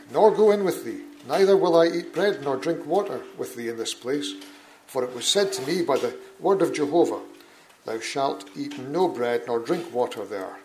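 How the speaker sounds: loud, ringing Bible reading; emphatic scripture reading. Acted. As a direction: proclaim the passage to the back of the hall. Reads scripture, growing forceful.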